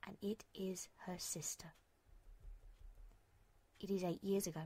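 hushed whisper of a painful truth. A narrator's reading. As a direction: drop to a bare whisper.

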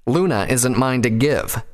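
indignant, drawn-out denial; firm refusal. A narrator's reading. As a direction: shut down a rival's demand. Brother refuses the demand.

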